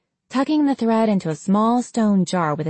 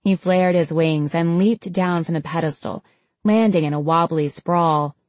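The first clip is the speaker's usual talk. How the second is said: usual speaking voice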